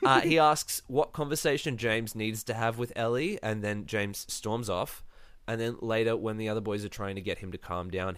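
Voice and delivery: normal talking voice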